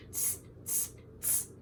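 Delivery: short voiceless "tss" noises; monkey chatter. A narrator's reading. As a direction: imitate mocking monkeys with three soft hisses. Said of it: "they shook their finger, and they said".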